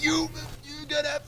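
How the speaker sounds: high yelled mock coaching; a mimicked high shout to pump up a fighter; imitating screaming coaches. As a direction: yell it up high like a fired-up cornerman. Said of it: "these guys were screaming at me".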